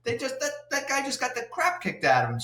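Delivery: high-pitched, stumbling mock disbelief; flustered witness. Retold inspector reporting the beatdown, stammering.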